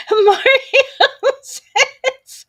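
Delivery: high, loud laugh through the words; laughing hard. Real, not acted. Streamer laughing while reading a chat message. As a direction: laugh as you read the name.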